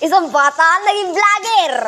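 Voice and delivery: formal newscaster announcing a story; news anchor voice. Pretend. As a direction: read it like a TV news headline. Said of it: "Ibabalita ko".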